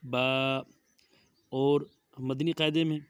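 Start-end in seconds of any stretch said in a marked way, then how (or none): 0.1-0.2 "با" with an open long a; Arabic ba pronunciation. Shown as a demonstration. Teacher corrects Urdu reading, Arabic "با" with open a. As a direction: say "با" as "baa", never "bay". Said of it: "اس کو پڑھا جائے گا".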